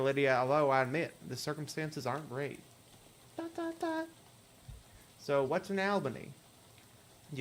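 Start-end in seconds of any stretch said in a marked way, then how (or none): 3.4-4.1 high voice reading the ellipsis; silent pause read out. Pretend. Lydia answering with silence, read in her high voice. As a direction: say the dots as the girl's silence.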